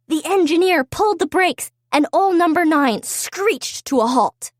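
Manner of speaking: usual speaking voice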